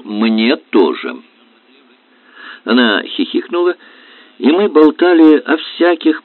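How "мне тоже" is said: plain reading voice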